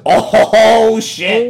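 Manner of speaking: high, loud drawn-out "oh"; gleeful reaction. Real, not acted. Host reacting to his own jab, loud whoop. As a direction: whoop it out with a laugh.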